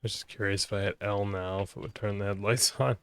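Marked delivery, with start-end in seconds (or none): none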